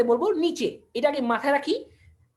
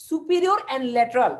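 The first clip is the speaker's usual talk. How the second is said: slow separate words; much slower than usual. Shown as a demonstration. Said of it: "দুইটাকে আমি কিভাবে একসাথে উচ্চারণ করবো".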